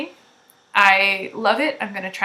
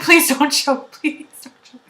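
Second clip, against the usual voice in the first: higher-pitched begging through laughter; laughing mock plea; 5 semitones higher, 5 dB louder than usual. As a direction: plead playfully while giggling. Retold plea to laughing friends.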